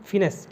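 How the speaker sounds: first syllable "fi", not "fai", in फिनेंस; correct pronunciation. Shown as a demonstration. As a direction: say फिनेंस as "fi-NESS", short first vowel. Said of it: "फाइनेंस को pronounce करोगे".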